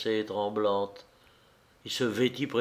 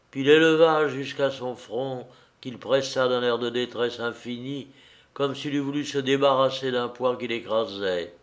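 ordinary delivery

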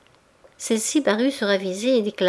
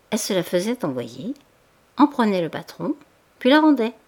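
ordinary delivery

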